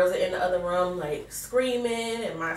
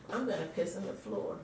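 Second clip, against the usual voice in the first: much softer, private mutter; inner thought; 11 dB quieter than usual. Retold or imitated. Woman thinking to herself during the countdown, hushed inner voice. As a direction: drop to a hushed aside to yourself. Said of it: "I'm not saying this out loud".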